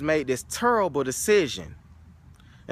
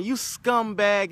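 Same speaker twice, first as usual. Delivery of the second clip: mock yell; 7 semitones higher, slower than usual. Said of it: "leaving the old guy yelling".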